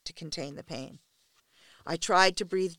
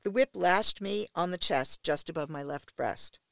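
ordinary delivery

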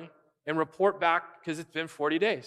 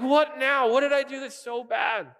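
whiny little brother; 8 semitones higher than usual. Retold or imitated. David protesting to his brother, whiny and high. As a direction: sulk back at a nagging sibling. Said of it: "such a little brother thing to say".

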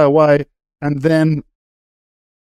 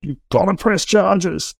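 pushy police officer; 5 semitones higher than usual. Retold or imitated. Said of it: "this overzealous police officer was hassling Kim".

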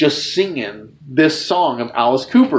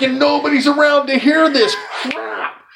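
high excited aside; 12 semitones higher than usual. Retold or imitated. Idol praises his band.

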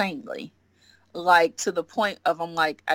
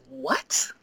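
stunned what; 8 semitones higher than usual. Retold or imitated. Stunned by the professor's answer.

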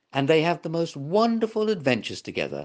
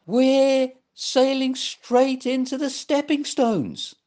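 high, slow frightened squeal; 12 semitones higher, 4 dB louder, slower than usual. Acted. Giraffe seeing the stones ahead, panicked high squeal. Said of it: "Get Up squealed".

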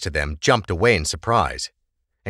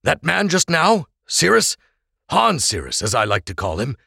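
explaining father; 9 semitones higher than usual. Acted. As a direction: make his friend understand. Oral explaining to Krebs.

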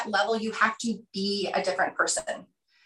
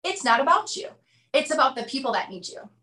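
high, punchy "Guess what?" as a jab; blunt provocation; 4 semitones higher than usual. Retold or imitated. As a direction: call the listener out with a sting. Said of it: "He goes".

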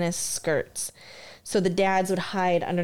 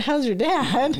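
higher mocking repeat; mocking correction; 6 semitones higher, slower than usual. Shown as a demonstration. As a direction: echo it back with scorn. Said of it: "No, not".

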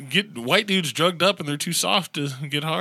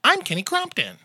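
newscaster demo; 9 semitones higher than usual. Shown as a demonstration. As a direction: say "I'm Kenny Crumpton" like a bright TV anchor.

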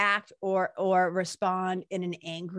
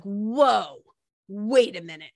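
retold amazement; 7 dB louder, slower than usual. Retold amazement at reading line.

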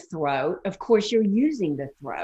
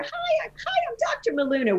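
squeaky greeting pitched far higher; ridiculously high voice; faster than usual. Shown as a demonstration. Coach mocking a too-high voice, squeaky and high. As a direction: overdo the pitch to show how silly it sounds. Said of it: "would be ridiculous".

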